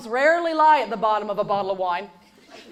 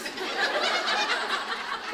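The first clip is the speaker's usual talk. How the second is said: breathy laugh; 12 semitones higher, 8 dB quieter than usual. Real, not acted. Laughs at own joke.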